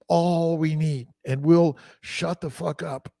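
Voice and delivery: usual speaking voice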